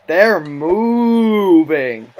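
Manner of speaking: slow stretched mock voice; drawn out. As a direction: stretch every word out slowly. Repeats, drawn out.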